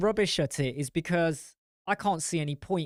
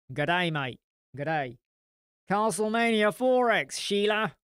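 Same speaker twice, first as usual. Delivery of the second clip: second, bolder Australian accent, louder on the end; hammed-up Australian, punching Castlemania four X and Sheila; 5 semitones higher, 4 dB louder than usual. Shown as a demonstration. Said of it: "No, that sounded more, no".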